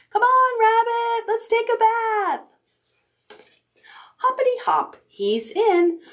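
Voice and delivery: high coaxing call; calling rabbit. Acted. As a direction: sing out to the bunny.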